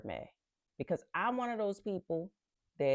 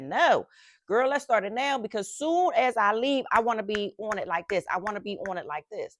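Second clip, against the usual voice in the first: loud, higher, eager insistence; emphatic, raised-voice refusal of the idea; insistent retort; 4 semitones higher, 8 dB louder than usual. Retold or imitated. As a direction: cut in firmly, raring to start. Acting out her insistent reply.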